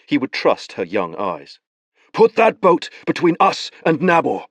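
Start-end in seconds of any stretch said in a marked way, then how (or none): 2.1-4.5 commanding. A narrator's reading. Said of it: "he told".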